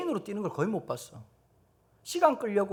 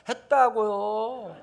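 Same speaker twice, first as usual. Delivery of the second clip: put-on very slow, higher, dragged-out child whine; sulky child; 4 semitones higher, 4 dB louder, much slower than usual. Seven-year-old sulking back at the teacher, slow whine. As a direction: drag it out like a sulky kid.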